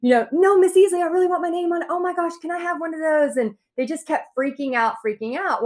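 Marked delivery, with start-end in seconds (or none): 0.3-3.4 put-on kids begging. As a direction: beg like an excited kid. Kids begging for painted boards.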